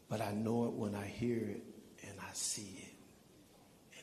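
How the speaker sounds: hushed, almost whispered; whispering. Real, not acted. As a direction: drop to a near whisper. Speaker drops to a whisper.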